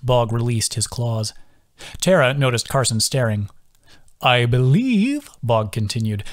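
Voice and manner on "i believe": put-on slow sing-song voice of a gentle old turtle. A narrator's reading. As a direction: draw each word out in a musical sway. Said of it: "His sing-song voice".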